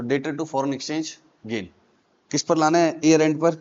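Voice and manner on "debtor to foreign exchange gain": slow dictation. Shown as a demonstration. Dictating the journal entry.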